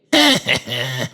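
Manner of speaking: short mocking boy's snicker; taunting laugh. A narrator's reading. Boy laughs at his toy knight, taunting. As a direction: snicker meanly in little pulses. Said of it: "changed into a taunting sound".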